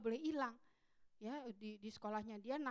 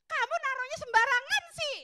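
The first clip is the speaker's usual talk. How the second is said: high, loud scolding nag; a mother blaming her son for his carelessness, high and nagging; nagging reproach; 11 semitones higher, 8 dB louder than usual. Retold or imitated. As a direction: pile on the blame like an irritated mom. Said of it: "pengen ngomong begitu".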